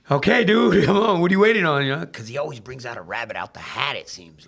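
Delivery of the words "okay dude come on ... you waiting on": higher, louder and quick with a laugh; impatient urging. Retold or imitated. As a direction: hurry your friend up, half laughing. Impatient stare at Woods.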